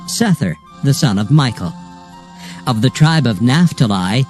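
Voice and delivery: ordinary delivery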